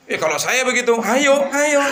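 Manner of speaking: playing a man giving in, overeager; mock eager man. Voicing an eager man in the story.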